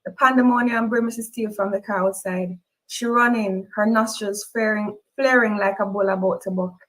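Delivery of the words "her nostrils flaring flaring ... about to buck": ordinary delivery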